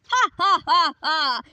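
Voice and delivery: loud gloating cackle; villain laugh. Acted. Villain gloating over stolen tech, loud cackle. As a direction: cackle over your prize.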